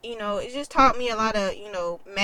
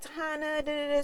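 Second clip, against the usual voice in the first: high, soft mimicry of a nurse; imitated coaxing nurse; 7 semitones higher, 4 dB quieter than usual. Nurse trying to talk to a crying patient, high soft mimicry. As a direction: mimic someone chattering at you, high and soft.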